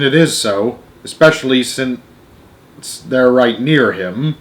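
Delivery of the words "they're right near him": ordinary delivery, nothing marked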